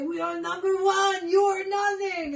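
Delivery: high, loud mock proclamation; shouted caricature of national pride; boastful French nationalists. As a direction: crow it shamelessly as a hammed-up French patriot. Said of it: "They're like".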